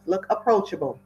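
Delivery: ordinary delivery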